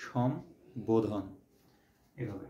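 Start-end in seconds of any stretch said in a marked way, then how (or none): 0.1-1.2 সম্বোধন drawn out very slowly, ব clearly sounded; slow correct pronunciation. Shown as a demonstration. Teacher gives the correct সম্বোধন, slowly stretched. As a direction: spell out সম্বোধন slowly with both ম and ব. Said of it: "সঠিক উচ্চারণটা হচ্ছে".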